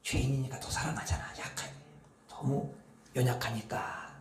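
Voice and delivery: whispered aside; soft confiding whisper. Real, not acted. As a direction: lean in and whisper it.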